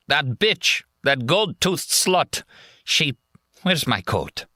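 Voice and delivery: put-on high, slurred drunk soldier railing; drunken rant. Drunk soldier cursing the woman who robbed him, high rant.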